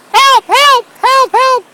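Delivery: high, loud shrieking woman's cry for help; female voice scream. Acted. Faking a woman's screams over the knocked-out dictator, shrieking. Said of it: "I scream in a female voice".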